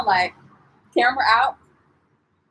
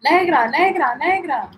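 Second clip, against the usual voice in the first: higher, sing-song repeated hawker cry; mimicked vendor call; 5 semitones higher than usual. Vendors calling her in Cartagena, mimicked calling. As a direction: chant it out like a street seller.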